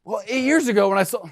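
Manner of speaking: mock Megan answering; Megan impersonation. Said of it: "That's my Megan impersonation".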